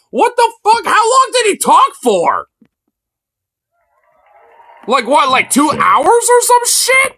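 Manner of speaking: high, loud incredulous outburst; high loud outburst in disbelief. Real, not acted. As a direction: shout in disbelief at how long he talked. Disbelief at speech length.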